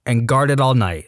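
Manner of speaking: usual speaking voice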